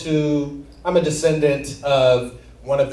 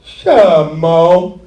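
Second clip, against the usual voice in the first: loud, punchy impression of a childhood friend; imitating friend Leon; 8 dB louder, much slower than usual. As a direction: shout it like a kid picking a fight. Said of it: "that's how he talked".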